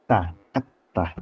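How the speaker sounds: "Ta, et" with the t blocked and held before release; held-back ta. Shown as a demonstration. Teacher repeats the tightly held ta for students. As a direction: catch the breath on "et" then release "ta". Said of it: "suara yang sangat tertahan".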